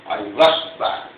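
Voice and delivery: ordinary delivery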